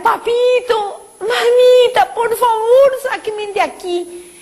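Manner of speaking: very high tearful cry to her parents, fading at the end; a daughter sobbing for her parents to save her; crying plea. Retold or imitated. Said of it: "Yo le empecé a gritar".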